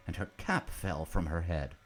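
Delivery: ordinary delivery, nothing marked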